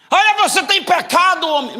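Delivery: high, loud accusing prophet voice; mock false prophet. Preacher mimicking a false prophet accusing someone. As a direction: sneer it out like a fake prophet.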